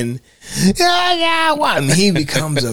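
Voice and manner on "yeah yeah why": put-on high whiny baby voice; mock whiny crying. Mocking a tough guy turned crybaby, high whine.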